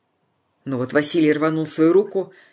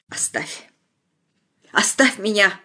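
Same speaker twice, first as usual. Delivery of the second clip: whisper to cry; 3 semitones higher, slower than usual. A narrator's reading. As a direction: push him off. Unvoiced then loud.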